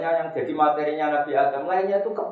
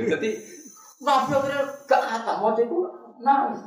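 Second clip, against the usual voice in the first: ordinary talk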